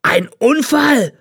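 high, drawn-out roar of disbelief; outraged bellow. A narrator's reading. Old man throws the boy's excuse back, bellowing. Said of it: "brüllte der alte Mann".

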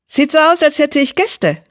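very high, pointed cat villain; high, sharp voice. A narrator's reading. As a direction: pitch it way up, thin and sharp. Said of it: "Die Stimme der schwarzen Katze klang hoch und spitz".

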